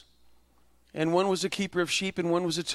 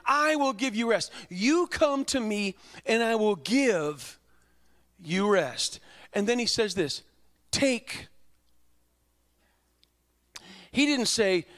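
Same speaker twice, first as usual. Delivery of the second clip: preaching; 5 semitones higher than usual. Acted. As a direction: preach the invitation with building fervor. Preacher voicing Jesus' invitation.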